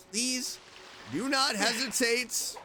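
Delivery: usual speaking voice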